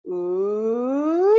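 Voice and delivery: long sung siren swoop; vocal siren. Shown as a demonstration. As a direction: slide smoothly up into head voice and down. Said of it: "So it sounds like this".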